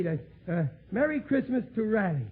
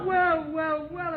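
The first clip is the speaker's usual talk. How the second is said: well, well pitched up high in delight; excited greeting; 9 semitones higher, slower than usual. Acted. Riley greeting his undertaker pal at the door, excited high greeting.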